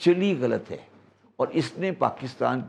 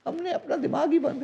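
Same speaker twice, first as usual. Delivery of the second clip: laughing; 13 semitones higher, 3 dB quieter than usual. Real, not acted. Laughing at own country's dependence.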